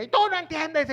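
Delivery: sharp high mock shout; grito de ejemplo. Shown as a demonstration. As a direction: shout it like losing your temper in an argument. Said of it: "Eso es gritería". (grito fingido, agudo y fuerte)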